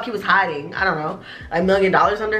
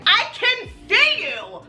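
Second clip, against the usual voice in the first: much higher, pointed sing-song; teasing the eavesdroppers, pitched way up; mock call-out; 13 semitones higher than usual. Calling out eavesdroppers at the table.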